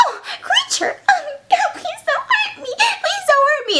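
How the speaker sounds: very high, whimpering damsel; mock frightened plea. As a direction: plead in a tiny squeal, barely holding back laughter. Elizabeth pleads with creature.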